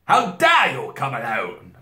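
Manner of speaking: a first try at a terrible queen, only moderately high; attempted queen voice. A narrator's reading. Queen rebuking the boy, a first-try terrible voice. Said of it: "that sounded not like it".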